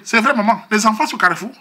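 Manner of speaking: high, loud imitation of the mothers gossiping; tut-tut like the mothers judging him; mocking mothers. Les mères du quartier se moquent. (imitation des mères moqueuses, aiguë et forte)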